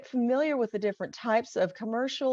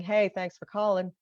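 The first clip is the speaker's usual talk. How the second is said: flat, casual phone answer with no smile; offhand, unenthused greeting; unsmiling phone greeting. Shown as a demonstration. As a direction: answer the phone without thinking how you sound. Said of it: "that has a totally different tone".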